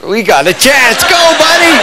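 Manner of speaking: excited poolside cheer; shouting encouragement. Retold or imitated. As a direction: yell him on from the poolside. Cheering Hoss on in race.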